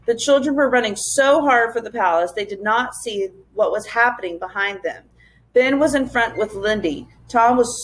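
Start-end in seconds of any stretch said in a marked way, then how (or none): none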